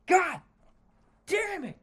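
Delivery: loud, high, drawn-out cursing shout; exasperated outburst. Real, not acted. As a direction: blow up in frustration, word by word. Exasperated outburst mid-rant at capitalism.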